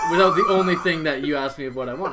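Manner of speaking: laughing while calling it out loud. Real, not acted. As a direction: burst out laughing mid-sentence. Laughing at his friend's blunder.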